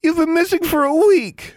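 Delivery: very high, loud tearful wail; sobbing girl wails at her returned roommate. Acted. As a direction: cry it out in relief and grief. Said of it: "Anna wailed".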